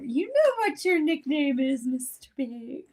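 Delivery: a lightly put-on sitcom voice, slowed right down; quoting Friends line. Quoting a Friends scene to a co-host, slow and high. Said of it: "all I can hear".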